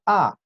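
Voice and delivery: "A" falling quickly from high; short, abrupt falling "A"; fourth tone. Shown as a demonstration. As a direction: drop "A" sharply and keep it short. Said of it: "Y el último tono".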